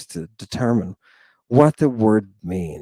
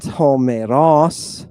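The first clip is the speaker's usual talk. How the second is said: "Homeros" with the last syllable "ros" stressed and drawn out; slow "Homeros", accent moved to the final syllable; misplaced accent; 4 dB louder, much slower than usual. Shown as a demonstration. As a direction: wrongly lean on "ros" at the end of "Homeros". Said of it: "but Homeros".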